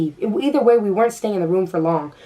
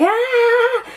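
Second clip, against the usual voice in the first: very high short crazy shriek; hammed-up high yelp; mock yelp; 4 dB louder than usual. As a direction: let out a silly high squeal. Crazy yelp after going off.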